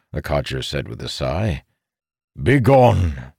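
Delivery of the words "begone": commanding. A narrator's reading. Codger dismissing.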